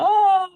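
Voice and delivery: high, drawn-out imitation scream; mock falling scream. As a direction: mimic someone screaming as they fall into lava. Imitates man falling into lava.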